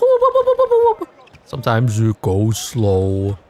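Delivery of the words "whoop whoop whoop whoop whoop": much higher, louder, quick whoops; fast timer whoop. Shown as a demonstration. Streamer imitating a timer running out fast with quick high whoops. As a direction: zip through like a timer flying by. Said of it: "sometimes it goes whoop".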